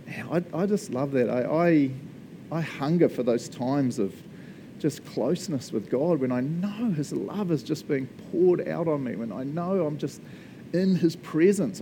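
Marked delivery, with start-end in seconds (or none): none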